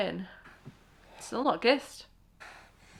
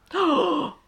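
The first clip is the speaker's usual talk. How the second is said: loud, high gasp; shocked gasp; 7 semitones higher, 7 dB louder than usual. Real, not acted. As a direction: gasp loudly in real shock. Shocked reaction to the film.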